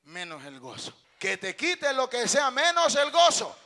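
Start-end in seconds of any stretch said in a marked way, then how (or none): 1.2-3.5 loud, rising declaration; emphatic shout. Real, not acted. Preacher urging brother to keep his joy, emphatic shout. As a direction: proclaim it with full conviction. Repeats the line forcefully.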